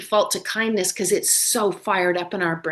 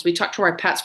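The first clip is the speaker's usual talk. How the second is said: ordinary delivery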